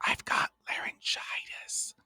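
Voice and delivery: voiceless stage whisper. Shown as a demonstration. Stutterer re-enacts telling everybody about laryngitis, whispered. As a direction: whisper it as if the voice is gone. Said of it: "I would only whisper".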